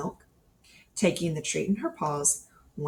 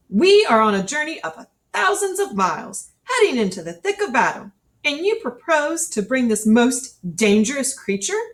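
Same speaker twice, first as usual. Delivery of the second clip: higher, louder blustering colonel; indignant colonel; 6 semitones higher, 6 dB louder than usual. Acted. Colonel scolding Harry on the train, loud indignant bluster. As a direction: scold the officer for bringing a bear.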